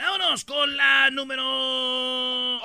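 slow, loud, high announcer call; announcer shout. Acted. Host announcing the next news item, drawn-out loud call. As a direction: stretch it out like a show host. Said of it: "host announcing next item".

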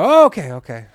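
laughing it off, caught out; backing down with a chuckle; sheepish laugh. Retold or imitated. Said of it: "And you go".

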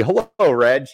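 ordinary delivery

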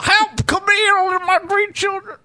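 high, slow, shouted comedian impression; Bill Cosby. Co-host acts comedian collapsing on stage, slow shouted impression. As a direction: shout it, stretched out as if seizing up. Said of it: "sounds like he's having a seizure".

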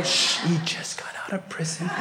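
hushed whisper; secretive whisper to dad. Retold or imitated. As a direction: whisper it under your breath. Hushing dad.

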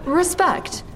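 high, drawn-out mock reverence; mock respect. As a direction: salute it with exaggerated awe. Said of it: "And to that I say".

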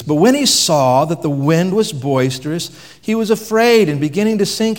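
normal talking voice

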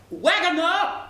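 high sparrow shout; crying out. A narrator's reading. Sparrow calling to the wagoner to stop, crying out. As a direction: call out high and urgent. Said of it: "he cried out".

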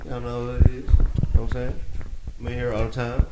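ordinary talk, nothing marked

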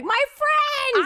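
put-on high, gleeful child voice; excited kid. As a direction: squeal like a happy little kid. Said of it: "like a kid going to kindergarten".

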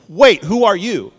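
loud, slow startled question. Acted. Blind dad to his son Esau, startled. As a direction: blurt it out, thrown and confused. Startled father in retold story.

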